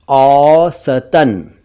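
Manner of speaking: "औसतन" very slowly as "aww-sa-tan"; correct three syllables. Shown as a demonstration. Teacher gives correct form, slow three syllables. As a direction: stretch "औसतन", open au, middle a sounded. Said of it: "दोनों ही गलत हैं".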